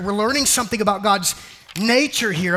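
normal talking voice

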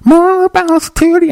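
sing-talk it through a talk box. Retold or imitated. Comic mimicking a talk-box voice like Zapp, high robotic tone. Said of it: "the little pipe like [name] Zapp".

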